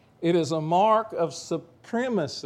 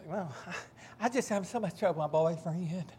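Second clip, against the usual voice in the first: lightly voiced teen girl, laughing through it; a hint of a whiny young girl; mock girlfriend; 5 semitones higher than usual. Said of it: "Some of you girls, you say".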